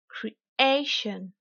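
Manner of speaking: "creation" in English, AY drawn out; teacher's over-stressed "creation", falling after AY; exaggerated English stress. Shown as a demonstration. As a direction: lean hard on AY in "creation", cree-AY-shun. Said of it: "je vais délibérément exagérer".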